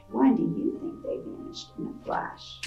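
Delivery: plain reading voice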